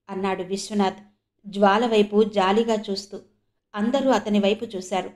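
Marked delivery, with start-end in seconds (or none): none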